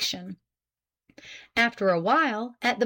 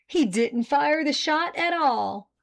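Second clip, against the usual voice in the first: higher, drawn-out good news; eager news; 6 semitones higher than usual. A narrator's reading. Man reaching her side with good news, eager. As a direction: stress the news, pitch lifted. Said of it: "he added".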